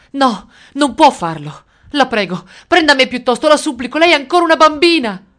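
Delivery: high, loud begging building in urgency; desperate pleading. A narrator's reading. Mother begging the soldier to spare her daughter, desperate. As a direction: plead frantically, voice climbing.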